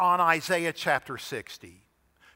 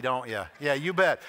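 normal talking voice

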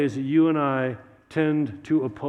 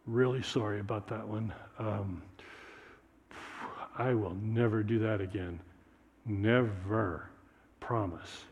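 soft, low, meek promise; a caught child mumbling sorry, softer; mild sheepish apology; 6 semitones lower, 9 dB quieter than usual. Acted. Mark apologizes to God.

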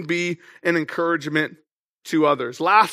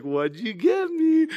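slow, higher eager "What'd you get me?"; mock-eager little girl, drawn out; excited child; 4 semitones higher, 3 dB quieter, slower than usual. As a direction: play the daughter who can't wait for her gift. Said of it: "she's so excited".

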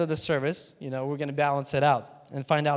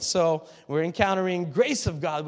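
ordinary talk

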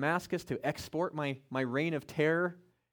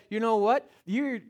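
mock snide retort; 6 semitones higher, 6 dB louder than usual. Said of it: "He didn't say".

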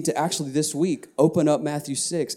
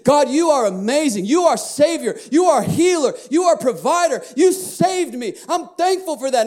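higher, louder praise, near shouting; 7 semitones higher than usual. Shown as a demonstration. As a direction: proclaim his names with full force. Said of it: "I'm calling back to who he is".

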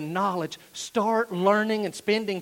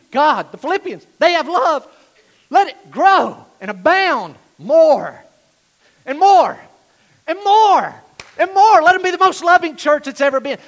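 loud, high cry building on each "more"; impassioned pleading prayer; 8 semitones higher, 14 dB louder than usual. Acted. Paul on his knees praying for Philippians, impassioned. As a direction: beg at full voice, stretching the key words. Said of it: "picture Paul on his knees".

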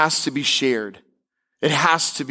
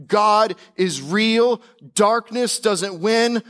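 preaching; 4 semitones higher than usual. Acted. Preaching, slowed and lifted.

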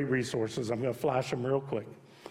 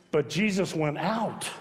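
usual speaking voice

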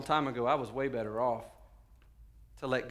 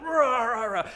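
loud, high wordless roar; imitated preaching bellow; mock rant; 10 semitones higher, 8 dB louder, slower than usual. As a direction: roar nonsense like a worked-up apostle. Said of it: "Peter does, he's like".

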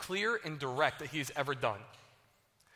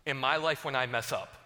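usual speaking voice

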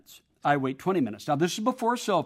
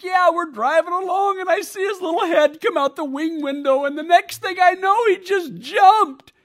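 much higher, louder tearful wife, slowing at the end; upset wife; 12 semitones higher, 6 dB louder than usual. Retold or imitated. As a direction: relive the jump for him. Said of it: "And she's like".

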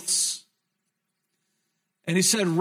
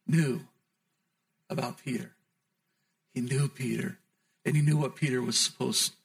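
hushed preaching; 7 semitones lower, 5 dB quieter than usual. Acted. Preacher tells of Peter weeping bitterly, hushed and low. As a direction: draw them in quietly to the sorrow.

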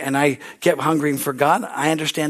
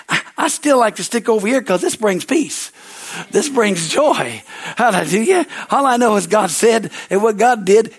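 preaching; 5 semitones higher than usual. Acted. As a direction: preach it out to the congregation, full force. Preaches at full force.

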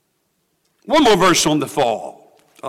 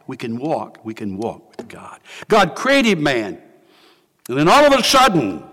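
usual speaking voice

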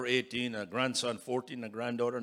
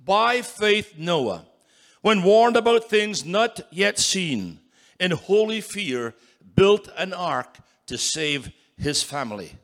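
slow, loud, higher, each phrase weighted; preacher reading Hebrews aloud with force; solemn scripture reading; 5 semitones higher, 10 dB louder, slower than usual. Shown as a demonstration. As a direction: proclaim the verse slowly. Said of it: "Read it again".

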